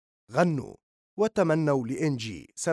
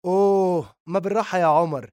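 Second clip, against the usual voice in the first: loud, high mock "ooh"; 5 semitones higher, 7 dB louder than usual. A narrator's reading. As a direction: jeer at her, loud and high. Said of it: "وبدأ الجميع في السخرية من هنا".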